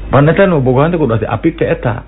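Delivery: ordinary talk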